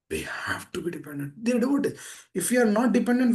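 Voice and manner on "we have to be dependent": softly whispered, breath only. Acted. Preacher whispering to devotees to make it sink in. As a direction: drop to a whisper to drive it home. Repeats key line in hushed voice.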